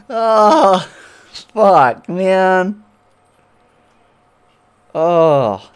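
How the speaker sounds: loud, high frustrated swearing; angry cursing. Real, not acted. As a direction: curse out loud, fed up.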